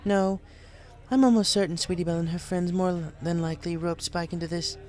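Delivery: amused and fond; upbeat Rarity. A narrator's reading. Rarity explains to the bartender, cheerful. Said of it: "in a much better mood".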